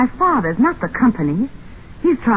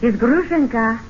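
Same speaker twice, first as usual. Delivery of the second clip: fake Russian accent; 4 semitones higher than usual. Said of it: "What happened to your Russian accent?".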